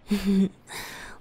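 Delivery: teasing chuckle; a flirty little laugh; playful laugh. Acted. Teasing laugh to beloved.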